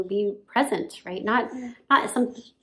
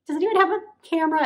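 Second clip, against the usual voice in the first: high fast eager question; excited; 9 semitones higher, 3 dB louder, much faster than usual. Retold or imitated. Labouring mother asking the midwives for a camera, eager. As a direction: blurt it out eagerly. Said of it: "I was asking, I'm like".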